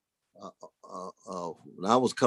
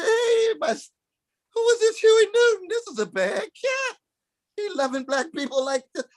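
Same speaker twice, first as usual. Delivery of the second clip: very high, near-crying voice of wonder; a young man overwhelmed by what he sees; awed excitement; 11 semitones higher than usual. Retold or imitated. Young man hearing Huey and Malcolm, awestruck and high.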